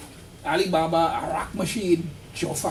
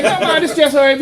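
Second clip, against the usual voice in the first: loud laughing friend; 10 semitones higher, 10 dB louder than usual. Retold or imitated. Friend insists, retold loudly.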